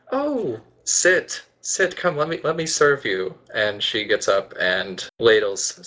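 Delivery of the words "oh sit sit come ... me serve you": high-pitched, smiling, fussing hostess; doting mother. Acted. Mother welcomes the newcomer to breakfast, doting and smiling. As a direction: fuss over the guest in a raised voice. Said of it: "Nero smiles at Sariel and says".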